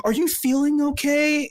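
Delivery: mock concerned guest. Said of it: "They're like".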